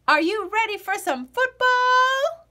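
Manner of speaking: high, loud stadium-announcer call; announcer shout. A narrator's reading. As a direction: belt it like a game host. Boy storms into kitchen cheering.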